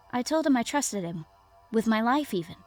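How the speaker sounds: ordinary delivery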